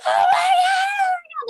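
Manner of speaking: very high, loud retold scream; freaking-out scream. Friends screaming down the hallway over dead hamster, panicked shriek. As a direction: shriek it as if fleeing in horror. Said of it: "freaking out".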